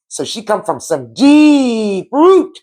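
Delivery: slow, high and drawn out. Real, not acted. Telling viewers of her sister's roots, slow emphatic. Emphatic deep-root proclamation.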